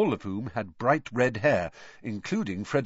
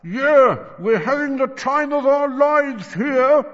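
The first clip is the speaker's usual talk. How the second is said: loud, high, squashed voice; muffled; 10 dB louder, slower than usual. A narrator's reading. George squashed against the wall, muffled, high and loud. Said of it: "whose voice sounded muffled".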